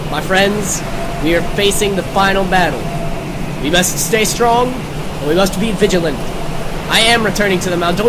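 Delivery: a touch of a heroic commander; rally the troops, hammed-up; pompous battle-eve address; mock rallying speech. Rallying party before final battle.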